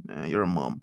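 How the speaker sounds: mock lukewarm reaction, dropped in pitch; unimpressed. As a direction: imitate a man losing interest. Same reaction turned unimpressed.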